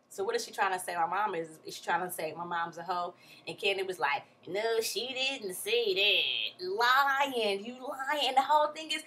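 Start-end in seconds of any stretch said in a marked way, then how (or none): 4.5-8.3 drawn-out mock denial. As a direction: drag the words out slowly. Retold denial, teller says lying.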